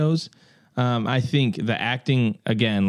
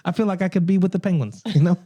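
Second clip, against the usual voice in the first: high put-on Gotham accent; hammy Gotham local voice; 5 semitones higher, faster than usual. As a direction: pitch yourself as a Gotham henchman in accent. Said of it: "This is my Gotham accent".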